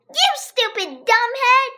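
put-on high, loud angry girl's shout. A narrator's reading. Andrea shouts at AJ, high and angry. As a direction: yell it at him, furious. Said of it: "Andrea shouted".

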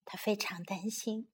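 hushed storybook whisper. A narrator's reading. Narrator whispering to children about worried Maya.

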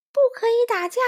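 very high, loud cry; shouting back. A narrator's reading. Small friend fleeing the wolf, shouting back. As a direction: shout it over your shoulder. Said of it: "欢仔回头喊了一句".

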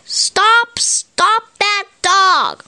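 high, slow yell after a running dog; shouted. A narrator's reading. Storybook voice yelling after the dog, high and slow. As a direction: cry out to stop the thief. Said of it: "A voice shouted".

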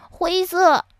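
put-on high, loud child-like cry of a story character. A narrator's reading. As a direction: cry it out as little Hawar. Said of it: "哈瓦尔叫道". (哈瓦尔高声叫喊)

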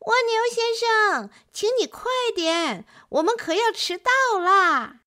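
高声急喊, 尖亮的乌龟嗓; 急着催朋友快点; 乌龟大声催. A narrator's reading. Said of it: "并且大声喊". (乌龟高声喊着催促)

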